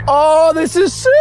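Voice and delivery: high-pitched excited cry; thrilled. Real, not acted. Photographer delighted by a sick spot, thrilled. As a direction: let the excitement lift your voice.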